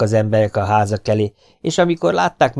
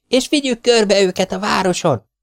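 put-on very high, gleeful boy's voice; excited boy; 12 semitones higher, 3 dB louder than usual. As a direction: bounce with glee at the plan. Domi excited. (nagyon magas, lelkes kisfiúhang)

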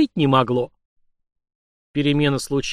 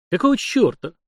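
higher-pitched, annoyed question; irritated demand; 7 semitones higher than usual. A narrator's reading. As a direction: demand an answer, vexed at the disturbance. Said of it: "заставив замереть от испуга всех присутствующих". (раздражённо, повышенным тоном)

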